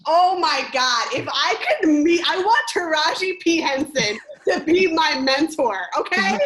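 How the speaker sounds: loud, high-pitched fan gushing; excited gushing. Real, not acted. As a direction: gush about your idol, voice soaring. Gushing over her favorite actress.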